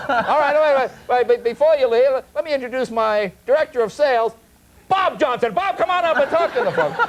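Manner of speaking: high, loud hammed-up sales pitch; eager salesman pitch. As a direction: sell it hard to a bored room. Salesman calling Bob up.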